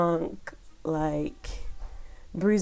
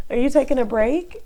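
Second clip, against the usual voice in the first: raised voice, called across the house; calling out; 6 semitones higher, 9 dB louder than usual. Real, not acted. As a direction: call out to her son in the next room. Calling out to her son.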